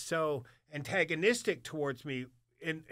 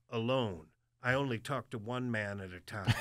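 low, lightly voiced hard old man; imitating a terse old-timer; old military man; 6 semitones lower than usual. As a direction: drop lower, a hint of a stern veteran. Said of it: "This old hard, like, military cat".

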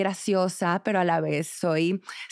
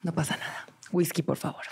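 mock calm self-talk; 3 semitones lower, 6 dB quieter, faster than usual. Calming herself on plane.

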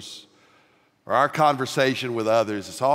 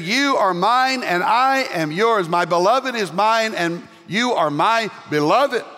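raised, slow, tender vow; Jesus' promise, lightly voiced; 7 semitones higher, 4 dB louder, slower than usual. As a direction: speak it as the bridegroom pledging. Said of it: "He said".

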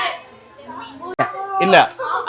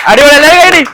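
calling for applause; 9 semitones higher, 7 dB louder than usual. Real, not acted. Host rallying applause.

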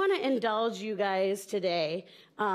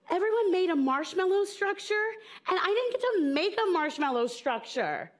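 put-on high, aggrieved child's complaint; upset child; 6 semitones higher than usual. Upset kindergartner to mom after school, acted complaint. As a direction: complain like a wronged five-year-old. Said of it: "And I was so upset".

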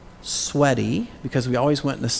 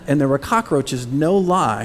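ordinary talk

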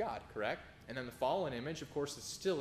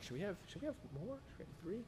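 much softer, fast, hushed wondering; soft musing; 5 semitones higher, 12 dB quieter, faster than usual. Retold or imitated. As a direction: murmur it like a private question. Couple musing about more kids.

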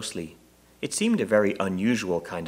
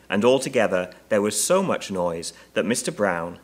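plain reading voice

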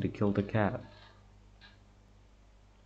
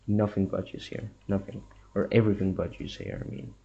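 ordinary talk

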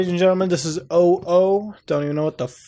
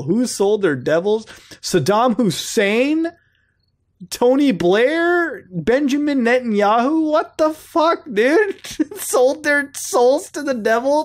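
slow, climbing higher with each name; rising disbelief; 8 semitones higher, slower than usual. Real, not acted. Reading out the list, getting higher and more incredulous. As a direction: drag each name out, more stunned every time.

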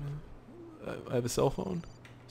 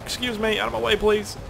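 calling out; 13 semitones higher, 7 dB louder than usual. Real, not acted. Calling to in-game friend.

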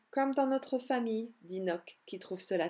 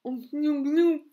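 higher, much louder stuck-in-sweater grunts; muffled grumbling grunt; 5 semitones higher, 9 dB louder, slower than usual. Acted. Nock stuck in his new sweater, muffled grunting. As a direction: grunt with the head stuck in the jumper. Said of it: "grogne Nock".

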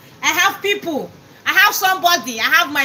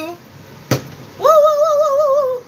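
very high, loud cheering woos; excited woos; 13 semitones higher, 4 dB louder than usual. Real, not acted. Celebrating a viewer's stars, very high loud woos. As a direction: cheer the gift with joy.

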